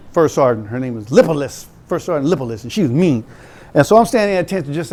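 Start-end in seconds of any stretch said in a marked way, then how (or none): none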